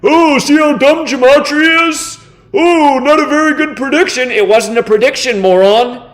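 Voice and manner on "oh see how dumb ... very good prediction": sneering high-pitched mimicry of critics; mocking falsetto sneer. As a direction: mimic them high and snide. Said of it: "be like".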